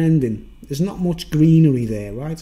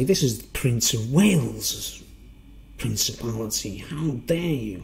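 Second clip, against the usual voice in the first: mock indignant official; 3 semitones lower, 3 dB quieter than usual. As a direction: draw it out like an affronted official. Acting the official arresting him.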